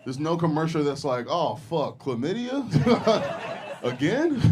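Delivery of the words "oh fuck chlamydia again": high, laughing fake shock; mock surprise. Comedian spoofs clinic ad, laughing mock shock. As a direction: gasp it out through laughter, higher.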